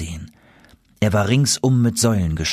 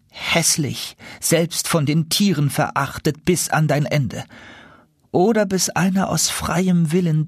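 mildly menacing curse, high then sinking; a touch of a terrible sorcerer; dreadful sorcerer's curse; 7 semitones higher than usual. Acted. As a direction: pronounce a curse, retold by its victim. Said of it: "rief mir mit schrecklicher Stimme in die Ohren".

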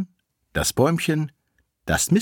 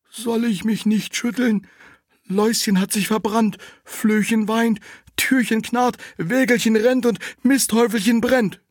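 little tree; 13 semitones higher, 3 dB louder than usual. Acted. Tree answers the girl.

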